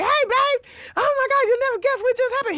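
high, loud, fast eager news; bursting to tell him; excited gush. Retold or imitated. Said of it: "I was all happy".

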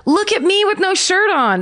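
high, playful come-hither tease; hammed-up flirty model pose; coy pin-up voice. Retold seventies pin-up pose.

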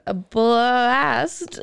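bleating goat-like "a blast". Pretend. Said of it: "my bad impersonation of a goat".

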